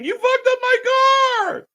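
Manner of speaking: very high slow whiny shout; hammed-up blubbering over a car; mock crying wail. Streamer mimics the wrestler crying in the ring, whiny shout. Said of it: "And he's crying".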